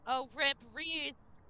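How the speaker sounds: usual speaking voice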